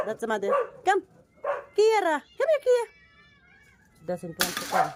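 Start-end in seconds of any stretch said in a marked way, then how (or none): 0.8-2.8 high, louder call to the dog. Real, not acted. Owner calling the mother dog who won't come, high and louder. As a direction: sing her name out high to bring her over.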